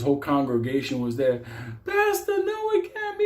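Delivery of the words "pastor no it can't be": much higher shrill cry of disbelief; shocked churchgoer. Retold or imitated. Congregation member cries out seeing the pastor arrested, high. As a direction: wail it like a stunned church lady.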